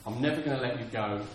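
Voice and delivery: low, gentle, halting comfort; tender reassurance. Acted. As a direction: speak softly as a loving God to a child. God acted reassuring believers.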